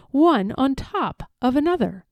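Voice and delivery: ordinary delivery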